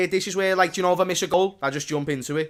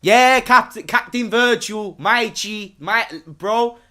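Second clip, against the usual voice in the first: put-on higher, louder street-slang accent, names drawn out; 5 semitones higher, 4 dB louder, slower than usual. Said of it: "I needed to say it in a different accent".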